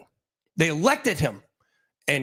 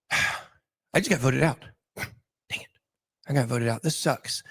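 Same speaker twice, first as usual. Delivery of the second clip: mock dejected grumble; 3 semitones lower, 3 dB quieter, slower than usual. Voted-out king grumbles.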